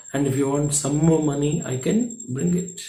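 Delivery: put-on barely audible, a child's shy murmur; very low voice. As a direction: drop it almost to nothing so nobody hears. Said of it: "said in a very, very low voice".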